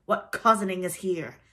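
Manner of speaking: loud angry demand; thundering. A narrator's reading. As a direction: demand it as an enraged captain. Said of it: "thundered Hook".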